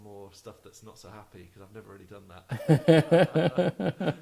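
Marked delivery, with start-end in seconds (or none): 2.3-4.2 laughing through the words, loud and slow. Real, not acted. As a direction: break into a laugh at your own joke. Jokes about only writing happy music.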